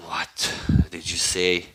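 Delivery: low, slow, soft challenge; quiet menace. Retold or imitated. Gaara answering Naruto's insult about his mother, quiet menace. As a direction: drop low and ask it slowly.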